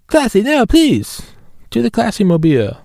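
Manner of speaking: very high pleading cartoon voice; South Park voice. Begging Classy, very high cartoon voice. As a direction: beg in a squeaky cartoon voice. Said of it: "God, I love South Park".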